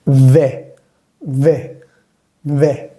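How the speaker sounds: De with open "eh" vowel; isolated drill of open French de; open è practice. Shown as a demonstration. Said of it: "Hagamos la práctica".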